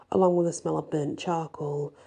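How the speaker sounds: usual speaking voice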